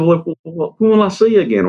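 high, stammering imitation of a daughter; flustered daughter. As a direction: stumble over it in a high daughter voice. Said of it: "And she said".

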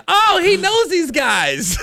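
naive realization. Retold or imitated. Mocks own naive assumption at the time.

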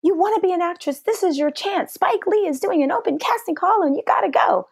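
imitating a thrilled friend; excited friend. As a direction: push her to go, all excited. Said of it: "And she says".

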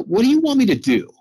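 high, pleading cry to God; begging God for an answer; lifted, pleading question; pleading prayer. Retold or imitated.

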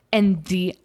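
usual speaking voice

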